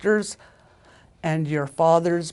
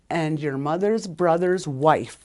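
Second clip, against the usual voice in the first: ordinary delivery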